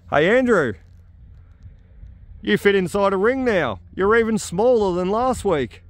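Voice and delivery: high teasing call across the paddock. Real, not acted. Ribbing mate Andrew from across the site, teasing call.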